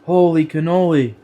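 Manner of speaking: high, loud and amazed; amazed guest. Retold or imitated. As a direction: gush like a wowed dinner guest. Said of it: "Everybody at Thanksgiving is gonna be like".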